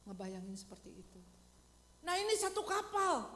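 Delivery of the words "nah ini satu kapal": ordinary talk, nothing marked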